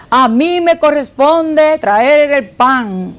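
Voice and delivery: slow, measured husband impression; lightly voiced man declaring his duty; self-important husband. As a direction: spell out his role slowly. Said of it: "el hombre viene y dice".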